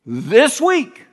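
loud, high, slow proclamation; dramatic preacher. Retold or imitated. Evangelist proclaiming the second coming, loud and slow. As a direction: thunder it like a revival preacher. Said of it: "Dramatic pause".

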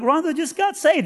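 high, elated shout-out; retold jubilant news; excited announcement. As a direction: proclaim it to the kids, high-pitched. Announcing her conversion to the kids.

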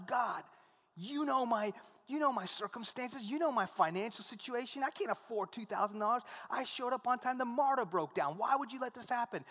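high, pleading complaint to God; angry prayer. Retold or imitated. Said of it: "I was so angry".

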